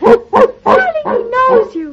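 wife thrilled the dog knows her husband, excited; delighted. Acted. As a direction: gush with excitement over the puppy. Mother delighted by puppy.

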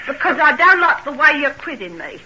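defensive flare of temper; getting angry. Acted. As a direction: throw back an irritated answer. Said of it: "Why are you getting angry?".

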